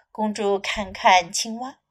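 plain reading voice

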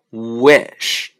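"wish" drawn out from an oo glide; careful word demo. Shown as a demonstration. Teacher giving examples of the w sound, slow careful demonstration. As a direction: start "wish" on a clear oo and lengthen it. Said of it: "下面其他的一些例子".

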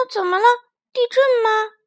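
high, loud worried cry; alarmed little creature. Acted. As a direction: panic about an earthquake. Creatures woken by shaking.

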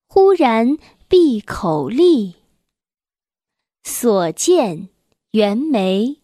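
loud, slow, measured classical poem reading; stately, loud children's poem recital; poem recitation. Acted. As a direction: recite it slowly with full projection, drawing out each line.

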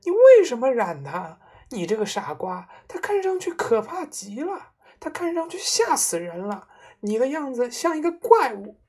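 high-pitched, horrified tirade; shrieking scolding. A narrator's reading. Mother berates her husband over his hair, shrieking. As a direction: shriek insults in dismay. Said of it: "妈妈尖叫着说".

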